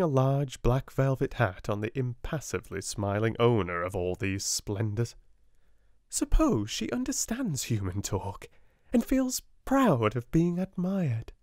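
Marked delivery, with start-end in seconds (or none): 6.1-11.3 a hint of a dreamy young girl, pitched up; a lightly lifted child's voice, wondering; lightly voiced girl. A narrator's reading. Sarah, a girl, voiced by narrator.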